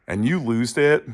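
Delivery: ordinary talk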